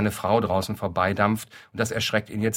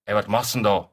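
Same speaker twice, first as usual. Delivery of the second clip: calling out. Acted.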